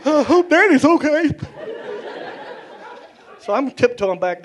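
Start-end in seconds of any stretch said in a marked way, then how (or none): 0.0-1.3 worried inner voice. Retold or imitated. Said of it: "thinking to myself".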